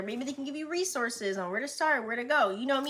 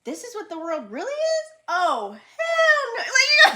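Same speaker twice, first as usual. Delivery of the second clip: high, loud laughing outcry; laughing disbelief; 13 semitones higher, 10 dB louder, slower than usual. Retold or imitated. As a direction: laugh it off in horror. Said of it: "and you're like".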